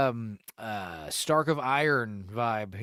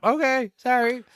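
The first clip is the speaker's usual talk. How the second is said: higher, louder hammed-up sheepish reply; cowed kid answering the driver; meek apology; 10 semitones higher, 6 dB louder than usual. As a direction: cower and apologize quickly.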